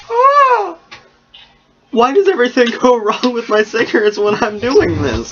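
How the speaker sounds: high, loud exasperated outburst; frustrated yelp. Real, not acted. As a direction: yelp as the cigarette fails. Cigarette goes wrong.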